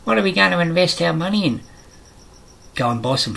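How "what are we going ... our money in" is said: high-pitched mimicry of a clueless crowd; mock them in a raised, put-on voice; higher put-on voice, mocking the question; mocking clueless investors.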